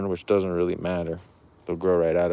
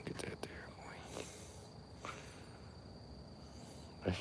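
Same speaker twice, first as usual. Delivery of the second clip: whisper; 9 semitones lower, 25 dB quieter than usual. Real, not acted. Hushed admiring aside.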